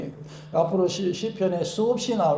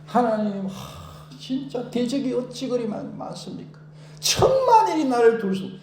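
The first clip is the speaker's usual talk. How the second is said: anguished cry; 7 semitones higher than usual. Retold or imitated. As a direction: cry out to God in distress. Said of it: "안타깝게 부르짖는 것". (애타게 부르짖는 기도)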